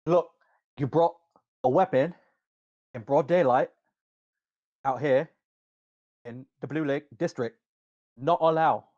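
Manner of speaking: halting British guard accent drifting towards mock Chinese; word-by-word scolding in a wobbly British-turned-Chinese accent; slipping British accent. Guard scolding over a weapon in daylight, slipping British accent. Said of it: "I don't know why that accent slipped".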